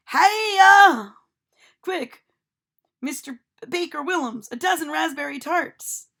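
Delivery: high, loud calling out; loud demand. A narrator's reading. Oddbones roars in, demanding tarts from the baker. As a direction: demand it from the carriage.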